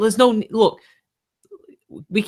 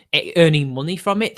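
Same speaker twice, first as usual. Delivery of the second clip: ordinary talk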